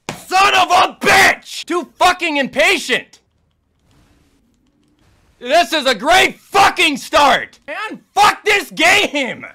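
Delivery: high, loud, dragged-out yell of rage; furious shout. Real, not acted. Gamer dies again and screams at the game in fury. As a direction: scream it like you're done. Raging at repeated boss deaths.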